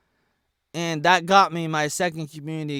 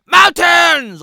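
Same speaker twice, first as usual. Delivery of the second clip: mock outcry; 11 semitones higher, 13 dB louder than usual. Mock outrage at banned word.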